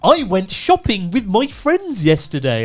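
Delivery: high, very slow, over-enunciated teacher voice; mock the over-enunciating English teacher. Mocking English teachers in Japan, a high, very slow enunciation. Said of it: "do the stupid voice".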